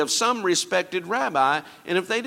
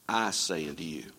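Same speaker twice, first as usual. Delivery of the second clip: solemn low quote; 12 semitones lower, 7 dB quieter than usual. Acted. Jesus's words quoted with weight.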